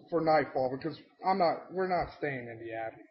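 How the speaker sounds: ordinary talk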